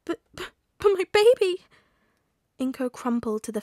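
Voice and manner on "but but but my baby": high, loud, stammering wail; stammer, then burst out in anguish; distraught mother. A narrator's reading.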